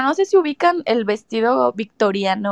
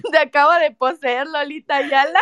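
laughing; 4 semitones higher than usual. Real, not acted. Laughing joke.

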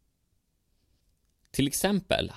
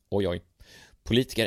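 plain reading voice

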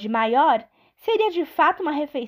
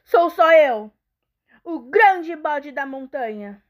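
very loud, slow booming goat; 2 semitones higher, 4 dB louder, slower than usual. Acted. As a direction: thunder it like a big goat. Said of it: "Urrou ... com sua voz mais estrondosa".

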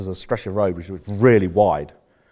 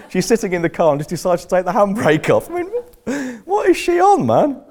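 high, loud laughing disbelief; incredulous laughter; 13 semitones higher, 4 dB louder than usual. Real, not acted. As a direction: exclaim it through real laughter. Laughing over a ridiculous driving story.

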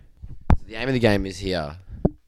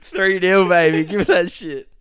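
triumphant gloat; 10 semitones higher, 7 dB louder than usual. Real, not acted. Gloating after winning the round.

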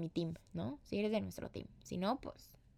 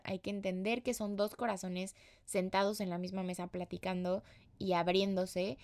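ordinary talk

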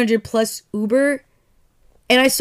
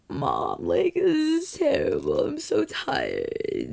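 whiny to mom; 7 semitones higher, 6 dB quieter, slower than usual. Retold or imitated. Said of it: "I called my mom".

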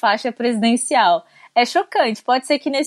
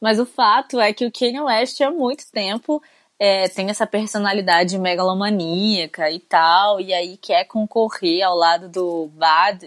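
usual speaking voice

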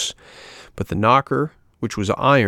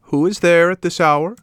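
high, loud calling snail voice; 9 semitones higher, 5 dB louder than usual. A narrator's reading. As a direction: call down high from the window. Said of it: "She called to him".

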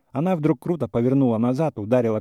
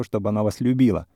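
ordinary delivery, nothing marked

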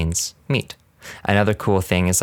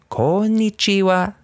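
very high, stiff, flat-held Konnichiwa; mock a synthetic tutor voice; exaggerated text-to-speech imitation; robotic language app voice; 12 semitones higher, slower than usual. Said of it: "the voice robots speaking always are like".

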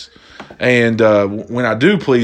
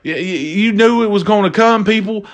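higher, excited stammer; hyped; 8 semitones higher than usual. Real, not acted. Vlogger hyping his viewers up, excited and higher. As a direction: build it up for the audience.

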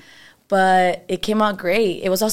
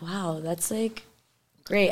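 soft, much quieter, wonder-struck; hushed awe; 6 dB quieter, slower than usual. Retold or imitated. Seeing the full moon at her party, softly awed. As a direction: breathe it out in quiet amazement.

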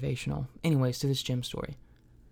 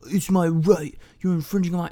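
mock indignant; 4 semitones higher, 6 dB louder than usual. Retold guy's indignant claim.

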